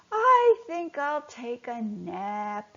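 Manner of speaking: slow, drowsy murmur; sleepy fish ready for a nap; sleepily. Acted. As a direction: drag the words out like you're dozing. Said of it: "said Finlock sleepily".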